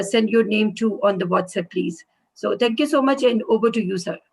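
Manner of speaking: usual speaking voice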